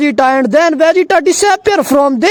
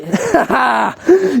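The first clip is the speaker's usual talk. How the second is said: villain's laugh. Acted. Beerus laughing at being slapped, mocking acted laugh. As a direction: laugh as the amused destroyer god. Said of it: "start lugging and say".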